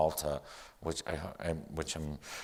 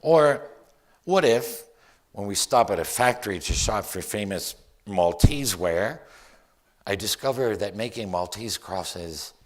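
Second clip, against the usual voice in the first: loud, emphatic poetry reading; poem recital; 3 semitones higher, 9 dB louder than usual. Acted. Poet dreaming up a whole new life in Malta, theatrical poem recital. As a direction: build each what-if into a rising, projected daydream. Reads own poem aloud.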